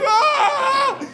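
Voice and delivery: very high cracked shout; desperate war cry. Retold or imitated. Comic flailing on stage, very high cry. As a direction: scream like a losing Rambo. Running with t-shirt cannon.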